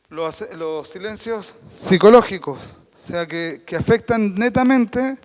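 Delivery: loud, slow words with pauses between; psychological pause. Shown as a demonstration. Teacher demonstrating a psychological accent, slow and loud. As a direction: leave a weighty gap before each word. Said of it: "Ese fue un acento psicológico".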